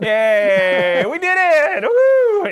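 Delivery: sarcastic cheer. Pretend. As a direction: cheer "we did it" with sarcastic glee. Mock celebrating own disaster.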